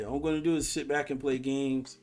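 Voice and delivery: normal talking voice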